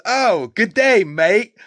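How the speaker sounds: put-on Lloyd's goofy Australian accent, cracking up; mock Australian accent. Retelling Dumb and Dumber joke, mock Australian accent with laughter. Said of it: "He goes".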